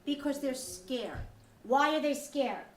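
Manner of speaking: ordinary talk, nothing marked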